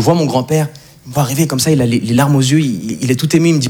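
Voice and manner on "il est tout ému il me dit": ordinary talk, nothing marked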